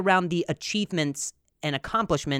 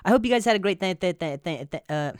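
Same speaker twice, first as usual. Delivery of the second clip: stuttering; 5 semitones higher than usual. Real, not acted. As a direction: start high, then stumble repeatedly on the first sound. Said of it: "and then I stutter real, real good".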